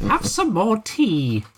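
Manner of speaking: put-on high, slow sweet old lady. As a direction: offer the tea like a doting granny. Quoting old lady Betty.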